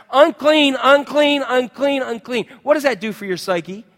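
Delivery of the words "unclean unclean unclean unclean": loud, higher calls of unclean, fading; yelled warning. Retold or imitated. Bleeding woman warning the crowd, loud fading calls. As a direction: call out unclean to the crowd, then die away. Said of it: "she had to yell this".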